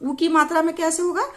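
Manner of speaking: ordinary talk, nothing marked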